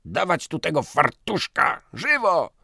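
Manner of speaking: loud, high barked command; shouted order. Acted. Piotrowicz shouts orders to bring the canvasser in, barking. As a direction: bark it at the staff.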